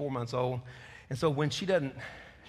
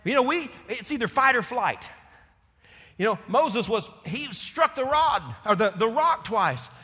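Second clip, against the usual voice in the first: higher and louder, urgent; preaching at full force; 8 semitones higher, 7 dB louder than usual. Acted. Preacher citing Moses and Elijah, loud and high. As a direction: drive the examples home.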